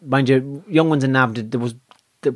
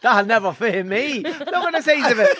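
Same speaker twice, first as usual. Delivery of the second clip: mock girl voice; 10 semitones higher than usual. Mock girl's voice about size.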